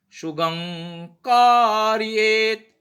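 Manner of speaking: सुगंकार्येत stretched out very slowly, the ग clearly sounded; slow drawn-out word. Shown as a demonstration. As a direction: draw out सुगंकार्येत syllable by syllable. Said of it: "इस type से, है ना?".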